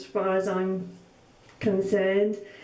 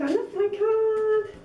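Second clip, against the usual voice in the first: a second, giddier I love my card; delighted squeal; 10 semitones higher than usual. Real, not acted. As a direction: gush over the card.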